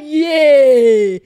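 long, loud, wailing yeah; loud drawn-out yeah. Retold or imitated. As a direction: belt out the yeah like a cry. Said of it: "yeah, I say".

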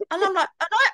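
usual speaking voice

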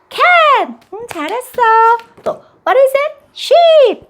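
high, bright teacher-mom voice; high cheerful quiz. Shown as a demonstration. Mom quizzing toddler on colors, high and cheerful. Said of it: "다시 한번 할게요".

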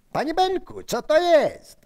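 high, loud call; wołanie za kimś. Acted. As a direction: call up to someone in alarm. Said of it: "Zawołałem za nim". (wysokie, głośne wołanie)

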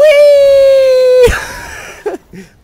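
loud high squealing laugh; shrieking laugh. Real, not acted. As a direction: burst into a screaming laugh. Car flips, he shrieks.

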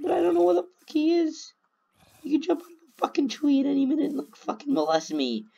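high, cracking nervous voice. Real, not acted. Player fearing a mob could jump out of a tree, voice cracking. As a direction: let the voice crack upward with nerves. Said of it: "My voice is cracking right now".

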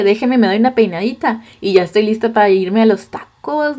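ordinary talk

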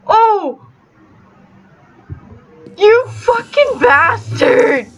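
screaming in outrage; furious shout. Real, not acted. Gamer yelling at teammate who killed her, furious shout. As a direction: yell it at the player who betrayed you.

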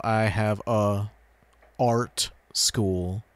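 "a", a gap, then "art school"; awkward pause. Shown as a demonstration. Showing why an exists, awkward halting pause between "a" and "art". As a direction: stall after "a" before "art school", drawn out. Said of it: "the pause makes it sound, uh, awkward".

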